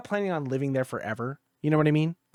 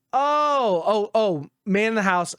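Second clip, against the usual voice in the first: mock realization; 6 semitones higher, 4 dB louder, much slower than usual. Sarcastic realization at door.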